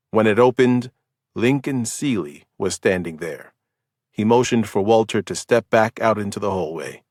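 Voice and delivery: ordinary delivery, nothing marked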